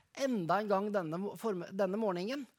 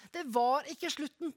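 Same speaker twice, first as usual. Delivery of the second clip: ordinary delivery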